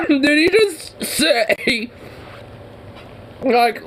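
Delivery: high, slow, laughing in disbelief. Real, not acted. Reacting to the clip, slow high laughing disbelief. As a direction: gasp it out between laughs, stunned.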